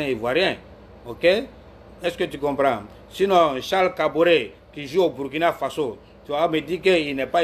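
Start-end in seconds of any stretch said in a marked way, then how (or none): none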